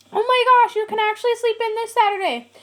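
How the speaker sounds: high, loud, gushing parent voice; mock excited parents. Mimicking her parents promising a lie-in, high gushing mock cheer. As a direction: ham up the parents' fake cheer. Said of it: "My parents were like".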